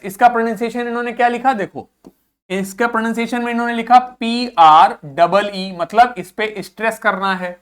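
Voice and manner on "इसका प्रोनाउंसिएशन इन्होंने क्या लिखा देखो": ordinary talk, nothing marked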